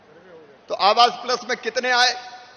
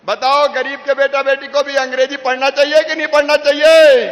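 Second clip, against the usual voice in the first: loud, higher orator's call; louder and higher, shouted out to the back rows; rousing rally shout; 3 semitones higher, 7 dB louder than usual. Real, not acted. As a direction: fire the question at the whole crowd.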